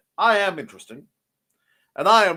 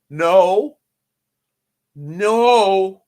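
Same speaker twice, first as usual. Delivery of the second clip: loud firm no; 3 semitones higher, 9 dB louder than usual. Real, not acted. Protesting cat scratch.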